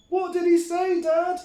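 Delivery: put-on very high, loud child voice; excited kid goat. A narrator's reading. Billy the Kid begs dad, high and loud. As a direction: squeal it out eagerly. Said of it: "asked the Billy Goat boys".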